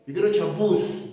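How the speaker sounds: champús said as a mock student's mistake; lightly voiced student asking for champús; wrong form, mock student. Shown as a demonstration. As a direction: play the student who says champús.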